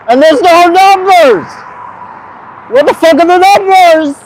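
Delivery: mock freak-out at the viewers, half-shouted; mock outrage. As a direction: fake a tantrum over the missing numbers. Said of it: "I'm just kidding".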